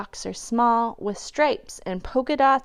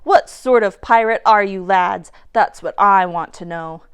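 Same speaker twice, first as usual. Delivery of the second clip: loud scolding, voice dropping low; angry pirate rant; 4 semitones lower, 7 dB louder than usual. A narrator's reading. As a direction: shame them, hard and loud. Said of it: "lashed out Dirty Joe".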